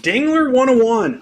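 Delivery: excited call-out. Real, not acted. Pulls a card, calls it out.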